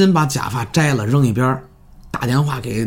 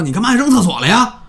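raised, indignant acted outburst; angry retort; 8 semitones higher, 4 dB louder than usual. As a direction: play an angry demand, pitched up high. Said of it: "特别生气的说".

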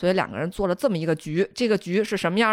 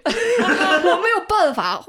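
laughing; 10 semitones higher, 4 dB louder, much slower than usual. Acted. Mock-innocent consort complaint.

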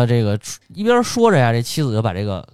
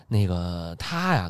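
slow and softer, buying time; hesitant stalling; 5 semitones lower, 5 dB quieter, much slower than usual. Retold or imitated. Stepmother stalling the father about his son, slow and soft. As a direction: drag the words out while inventing a lie.

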